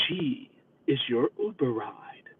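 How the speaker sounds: whispered, soft and ominous. Retold or imitated. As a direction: hand her over in a sinister whisper. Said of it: "she sounded rather ominous with that".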